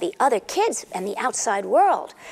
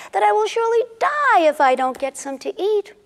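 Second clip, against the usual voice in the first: pleading wife voice; 7 semitones higher than usual. Acted. Wife's craving, voiced plea.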